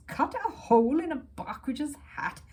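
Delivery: outraged disbelief at the idea; mock-scandalised exclamation; exclaiming. A narrator's reading. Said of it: "he exclaimed".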